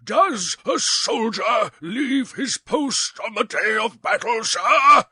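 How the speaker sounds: high, slow, rising to a shout on sir; angry exclamation. A narrator's reading. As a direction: hurl the question at them. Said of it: "exclaimed the prince".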